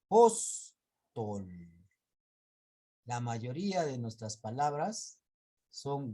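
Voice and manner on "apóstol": Apóstol said very slowly and louder, stress on pós; stress demo. Shown as a demonstration. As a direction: draw out Apóstol syllable by syllable and hit pós. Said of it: "acá son tres sílabas".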